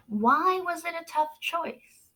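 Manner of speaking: plain reading voice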